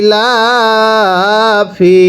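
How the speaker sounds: ইলাফি held long and very slow; teacher drawing out ইলাফি; slow drawn-out reading. Shown as a demonstration. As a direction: model the word slowly for pupils. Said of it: "আবার পড়তে হবে".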